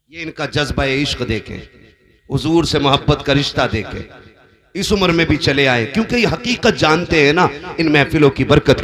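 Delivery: very loud, ringing sermon delivery; full-force preaching. Acted. Preacher praises the elderly man's devotion, full-force preaching. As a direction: proclaim it to the whole gathering.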